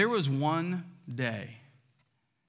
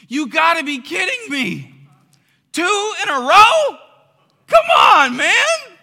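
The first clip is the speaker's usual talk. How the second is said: much louder and higher, exasperated shout with a laugh at the end; Pastor Mark exasperated; 13 semitones higher, 13 dB louder, slower than usual. Retold or imitated. Injured pastor railing at missing a second Easter, laughing exasperated shout. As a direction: rail at bad luck, half-laughing in disbelief. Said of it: "I can hear Mark saying".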